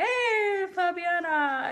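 high, drawn-out hailing greeting; imitated fan greeting. Imagined follower greets the vlogger, high mock hailing. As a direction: call the name like a fan, starting high and sliding down.

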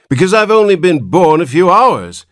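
put-on high-pitched old man's complaint, easing down; querulous whine. A narrator's reading. Newborn old man claims the Button name, querulous whine. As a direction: whine high and loud, then settle a little. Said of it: "replied the querulous whine".

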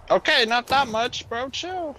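high, loud startled yelp; panicking gamer. Real, not acted. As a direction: panic as the hit lands. Enemy hurting too much, panics.

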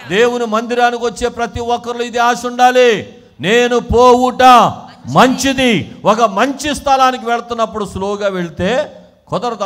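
loud, high, insistent preaching; preaching at full force. Real, not acted. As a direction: urge the congregation on forcefully.

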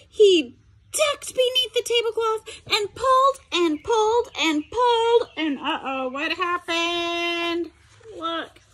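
ordinary delivery, nothing marked

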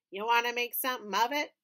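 fast mock tough guy voice. A narrator's reading. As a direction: puff up like a tiny gangster. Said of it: "in his best tough guy voice".

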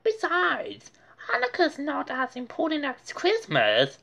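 high, quick haughty dismissal; snooty. A narrator's reading. Muffy belittling Hanukkah to Francine. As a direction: say it with spoiled arrogance. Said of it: "Muffy said".

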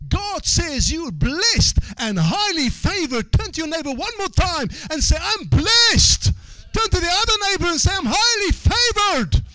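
high, loud revival shout; shouted, high-pitched exhortation to the congregation; full-force preaching. Real, not acted. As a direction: build to a soaring pulpit cry.

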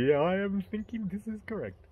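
Indian accent. Shown as a demonstration. Answering praise of his act, still in mock Indian accent. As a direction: agree in a put-on Indian accent. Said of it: "So I speak Indian".

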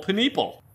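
"paneeple" said puh-NEE-pul; joke mispronunciation. Shown as a demonstration. Joking on tomato-tomato, "paneeple" said puh-NEE-pul. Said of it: "Do you pronounce it".

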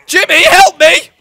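loud, high, slow plea; panicked cry for help. Real, not acted. Overrun by vexes.

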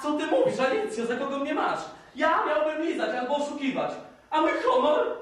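outraged shout. Acted. Paweł protesting his honour to Alicja, loud outraged shout. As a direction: bristle with wounded pride, shouting.

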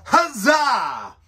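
high, loud festive shout; joyful Renaissance fair cheer for a tip; yell huzzah. Pretend. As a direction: cheer it like a fairground crowd. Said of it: "We yell".

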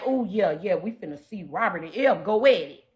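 a low, soft sarcastic imitation of hyped viewers; mocking excited viewers. Mocking the chat's hype, low and soft. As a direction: sneer it low and quiet like a gossiping fan. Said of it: "Like, y'all excited".

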